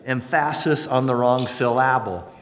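"emphasis" as em-FASS-is, "syllable" as sil-LAB-le; wrong-syllable stress. Shown as a demonstration. Preacher joking about Pharisees, misplaced stress on "emphasis" and "syllable".

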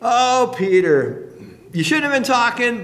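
tut-tut at a foolish friend; preacher chiding Peter playfully; mock scolding.

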